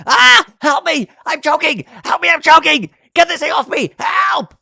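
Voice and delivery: very high, loud shrieks for help; screamed choking cries. Acted. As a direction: shriek it as if being choked. Host recites screamed team name.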